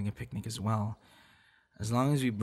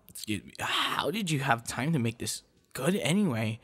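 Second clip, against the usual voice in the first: usual speaking voice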